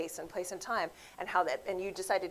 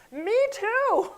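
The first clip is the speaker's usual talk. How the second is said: squeaky, loud, high me too; mock self-centred reply; 14 semitones higher, 11 dB louder than usual. Self-absorbed commenter hijacking the news, squeaky mock voice. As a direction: blurt it like a selfish friend. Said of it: "people are like".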